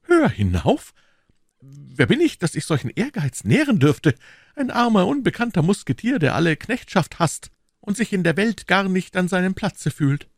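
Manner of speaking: high, lilting voice of a self-effacing musketeer; Aramis. Acted.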